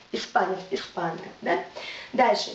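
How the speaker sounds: usual speaking voice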